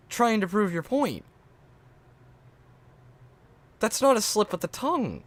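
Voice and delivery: high-pitched protesting; insist, voice pushed way up; baffled, squeaky indignation; exasperated disbelief. Real, not acted. Keeps rejecting the excuse.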